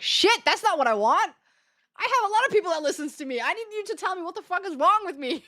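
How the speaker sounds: high, loud, laughing protest; high and loud, half laughing; exasperated outburst. Retold or imitated. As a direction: demand answers through a laugh. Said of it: "I'm like".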